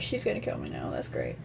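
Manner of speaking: low, soft mock-doomed mumble; resigned inner aside. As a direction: drop to a quiet, resigned aside. Inner dread voiced in the story.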